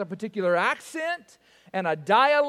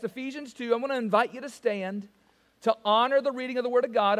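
ordinary delivery

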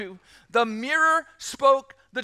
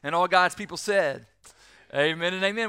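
prayer; 3 semitones lower than usual. Acted. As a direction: pray it gently and sincerely. Closing pastoral prayer.

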